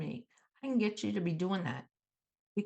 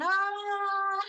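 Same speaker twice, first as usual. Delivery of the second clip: sudden forced laughing; 6 semitones higher than usual. Shown as a demonstration. Coach showing how to deflect someone coming at you, forced laughing. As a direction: break out in exaggerated, out-of-nowhere laughter. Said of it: "just start laughing".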